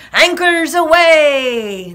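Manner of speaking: loud, drawn-out call, pitched high; captain's order. A narrator's reading. Captain Jack orders his pirates to sail, loud commanding call. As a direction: call it out across the deck. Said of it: "brave Captain Jack ordered".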